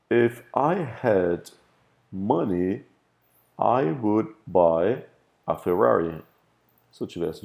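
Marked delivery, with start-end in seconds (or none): 0.1-6.2 slow example read. Shown as a demonstration. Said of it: "teacher reads example sentence".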